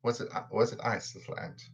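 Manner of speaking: soft, trailing-off imitation of the question; quiet imitation. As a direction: echo Peter's line almost under the breath, fading on ant. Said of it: "What was that?".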